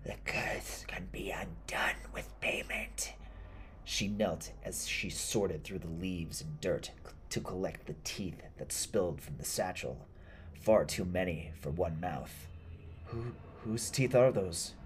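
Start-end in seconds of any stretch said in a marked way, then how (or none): 0.0-3.1 slow, eerie whispered rasp; raspy old woman. Acted. Old woman explaining the curse to him, whispering raspily. 13.1-14.7 higher, stammering, frightened question; trembling. Acted. Elliot asking about the spilled teeth, trembling. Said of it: "Elliot trembled".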